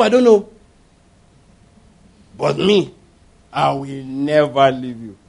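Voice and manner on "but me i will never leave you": slow, low, overblown pledge; solemn drawn-out vow. Acted. Peter swearing loyalty to Jesus, solemn drawn-out vow. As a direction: stretch out the promise with grand self-assurance.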